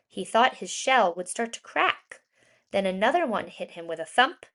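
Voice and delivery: plain reading voice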